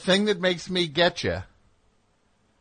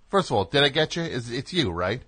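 ordinary talk

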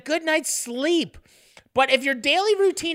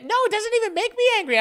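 quick denial; 10 semitones higher, much faster than usual. Real, not acted. Protesting denial.

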